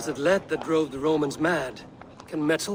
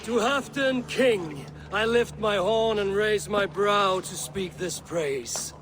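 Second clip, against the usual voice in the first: slow, raised-pitch proclamation; ceremonial toast; 4 semitones higher, slower than usual. Acted. Guest raises a horn to the new king, solemnly proclaiming.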